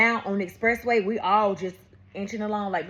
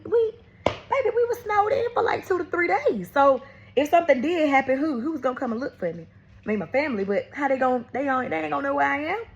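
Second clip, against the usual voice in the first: pitched way up, fast and frantic; rising panic; 7 semitones higher than usual. Real, not acted. Mother recalling fear of a stranger, panicky and high. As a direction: voice climbing in alarm.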